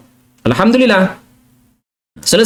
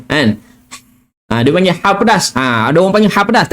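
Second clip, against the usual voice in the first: ordinary talk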